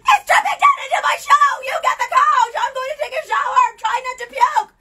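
high, loud frantic yell; mock panicked yelling; retold panicked shout. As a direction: yell orders while fleeing. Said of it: "I'm like".

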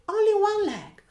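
ordinary delivery, nothing marked

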